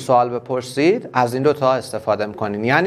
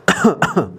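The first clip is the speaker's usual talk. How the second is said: short, deliberate fake cough; teacher's acted-out cough; demonstrative cough; 2 semitones higher than usual. Shown as a demonstration. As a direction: cough to show what the word means. Teacher acts out the word cough.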